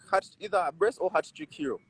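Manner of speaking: usual speaking voice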